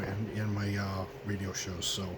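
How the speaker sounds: ordinary talk, nothing marked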